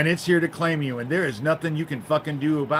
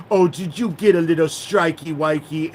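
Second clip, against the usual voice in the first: put-on higher, louder sneering baby talk; mocking Pootie Twang; 4 semitones higher, 5 dB louder than usual. Mimicking a YouTube critic's taunt about strikes, in mocking baby talk. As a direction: taunt it in a babyish singsong, pitched up. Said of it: "That's how he said it".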